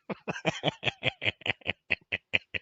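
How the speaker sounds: squealing high laugh; high cackle. Real, not acted. Laughing at the clip, squealing soft cackle.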